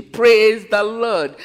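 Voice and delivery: loud, slow, drawn-out churchy "Oh, praise the Lord"; mock-jubilant thanks, stretched out slowly; exaggerated praise. Mocking people thankful for good things.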